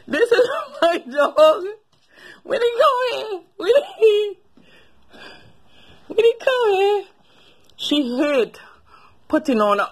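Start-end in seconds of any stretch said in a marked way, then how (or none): 0.1-1.6 higher, slow, through real laughter; can't hold back the giggles while saying it; laughing. Real, not acted. Laughs while introducing her dog. 2.4-7.0 much higher, whiny-sweet call to the dog. Real, not acted. Calls the dog in pet voice.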